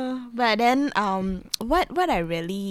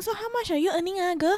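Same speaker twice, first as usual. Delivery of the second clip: high, prying auntie question; pry like a nosy relative; 11 semitones higher, much faster than usual. Retold or imitated. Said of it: "they will be asking".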